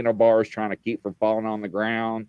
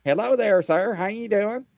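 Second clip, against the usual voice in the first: higher, sing-song mock greeting; sweet receptionist; 9 semitones higher than usual. Retelling the lady at the glass greeting him, higher chipper mock voice. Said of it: "She's like".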